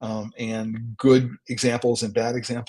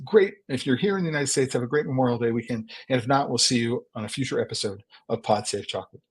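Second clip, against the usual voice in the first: normal talking voice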